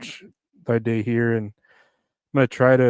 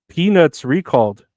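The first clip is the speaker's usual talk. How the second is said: amused surprise; 4 semitones higher, 7 dB louder than usual. Real, not acted. Reading funny headline.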